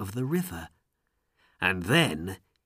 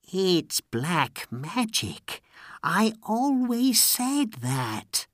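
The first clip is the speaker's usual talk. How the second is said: slow, high insinuating voice; drawn-out, scheming character voice; sly vizier's aside; 9 semitones higher, slower than usual. A narrator's reading. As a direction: drop poison slowly into the ruler's ear. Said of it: "the vizier said quietly in the Sultan's ear".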